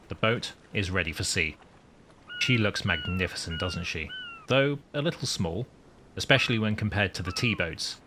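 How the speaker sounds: deliberate, composed storytelling; captain's log narration. Acted. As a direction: narrate it like a submarine commander's log.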